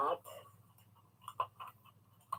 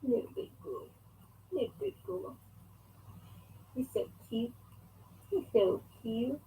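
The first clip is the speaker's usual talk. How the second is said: soft cooing; 14 dB quieter than usual. Real, not acted. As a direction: coo quietly to a little one. Cooing to little Emma.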